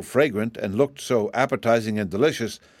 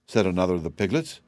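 usual speaking voice

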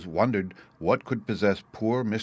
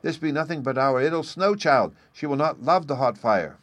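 put-on high-pitched, insistent child's voice; childish protest, pitched well up; earnest little child; 6 semitones higher, 4 dB louder than usual. A narrator's reading. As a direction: insist with all a small child's might. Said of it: "so mightily was he in earnest".

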